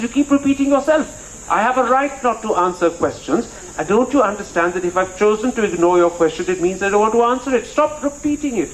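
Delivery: high-pitched exasperated mother voice; imitating mum telling him off; scolding mother. Retold mother scolding son.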